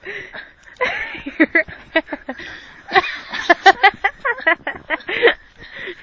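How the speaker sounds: high loud helpless laughter; can't stop laughing; laughing fit. Real, not acted.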